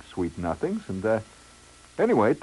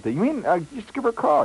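high-pitched, tentative question; acted feigned surprise; 4 dB louder than usual. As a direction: ask it high, as if innocent. Acted feigned innocence to friend.

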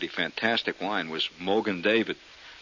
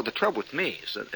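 usual speaking voice

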